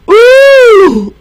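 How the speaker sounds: high, loud amazed "Ooh"; delighted gasp. A narrator's reading. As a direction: gasp in wonder at the new duckling. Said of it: "gasped Mother Duck".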